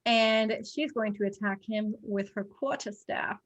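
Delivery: usual speaking voice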